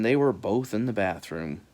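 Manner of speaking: ordinary delivery, nothing marked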